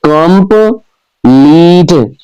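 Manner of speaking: Complete drawn out slowly, com-pleet, each syllable apart; slow syllable split. Shown as a demonstration. Teacher sounding out a word so pupils count syllables, slow and spaced. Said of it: "divide the given words into their syllables".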